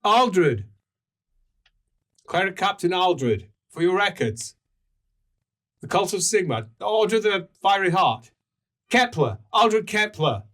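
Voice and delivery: high, forceful proclamation of titles; proud cleric captain. Acted. Cleric giving name for the records, proclaiming. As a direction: announce yourself formally, in emphatic bursts.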